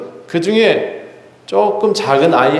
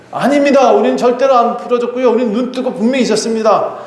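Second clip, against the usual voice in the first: guards protesting; 8 semitones higher than usual. Acted. Guards denying under interrogation.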